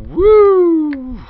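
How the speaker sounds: high, loud cheering whoop; a big celebratory woo. Real, not acted. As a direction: whoop in triumph. Cheering in game.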